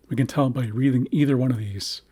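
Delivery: usual speaking voice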